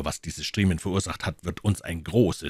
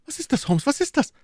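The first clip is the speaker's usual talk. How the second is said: high, urgent repeated question; terrified headmaster begging for answers; alarmed demand; 4 dB louder, faster than usual. A narrator's reading. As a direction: press Holmes, frightened. Shocked man demands from Holmes.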